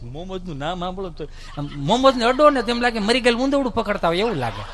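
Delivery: normal talking voice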